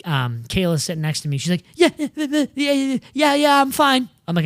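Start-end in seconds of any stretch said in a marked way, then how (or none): 1.8-4.1 high-pitched, stammered reassurance; imitating rattled fiancee. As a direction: put on a higher voice, repeating yourself. Imitating fiancee after the rollover.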